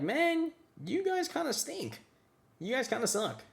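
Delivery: whiny grumble. Retold or imitated. Grumbling at friends.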